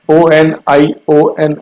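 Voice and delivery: slow, letter-by-letter "ओ एन आई ओ एन"; spelling out. Shown as a demonstration. Teacher spelling onion for the class, slow separate letters. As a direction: spell "ओ एन आई ओ एन" slowly, each letter apart. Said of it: "onion की spelling होती है".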